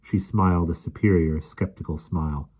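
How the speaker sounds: plain reading voice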